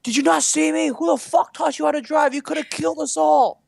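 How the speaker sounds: high-pitched imitation of furious yelling; mock road-rage tirade at a high pitch; aggressive Boston driver. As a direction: berate the other driver, hammed up. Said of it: "start banging on the other guy's bus".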